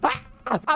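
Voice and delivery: high sudden shriek of fright; short frightened scream; startled scream. Real, not acted. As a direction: yelp as you get spooked. Sudden scare.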